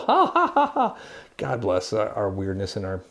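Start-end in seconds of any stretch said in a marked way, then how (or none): 0.1-1.0 high mock laugh. Real, not acted. Spelled laugh.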